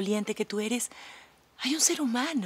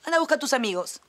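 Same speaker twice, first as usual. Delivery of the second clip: higher, louder shooing him off; dismissive brush-off; 5 semitones higher, 3 dB louder, faster than usual. Acted. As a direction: wave him away like a pest. Shooing Tito away. (despectiva, espantándolo)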